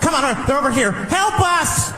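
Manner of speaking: high panicked calling out for help; frantic stranger. Acted. Stranger running up to a fake cop begging for help, high panicked. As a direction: beg the officer, high and desperate.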